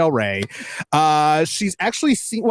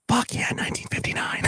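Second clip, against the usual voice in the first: whispering; 7 dB quieter than usual. Retold or imitated. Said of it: "He's like".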